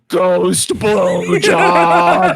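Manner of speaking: drawn-out mock yell; imitating a yell. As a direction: stretch it out like a friend yelling in disbelief. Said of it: "Derek just yells".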